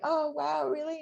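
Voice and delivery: high, teary surprised wonder; retold moved surprise, lightly done; touched surprise. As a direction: disbelief through tears. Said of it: "I was like".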